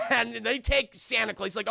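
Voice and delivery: normal talking voice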